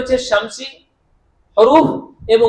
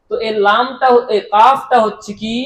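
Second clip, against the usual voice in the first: ordinary talk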